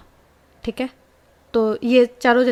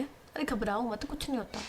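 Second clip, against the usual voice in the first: gentle reassurance; 12 dB quieter than usual. Acted. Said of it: "उसके हाथ रब करते हुए बोलती है".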